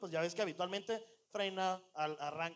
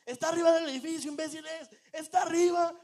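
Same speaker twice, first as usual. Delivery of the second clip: high, loud yell to teammates; yelling at clueless friends; re-enacted shouting; 9 semitones higher, 9 dB louder than usual. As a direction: shout an urgent warning into the game mic. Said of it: "le estaba gritando a mis compas".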